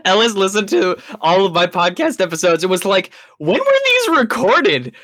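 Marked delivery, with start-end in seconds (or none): 3.4-4.9 quoted question. Retold or imitated. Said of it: "Your voice sounds so different".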